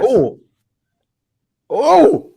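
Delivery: jolted yelp at a near goal; startled shout. Real, not acted. Reacting to a near goal on stream, loud high yelp. Big chance in stoppage time.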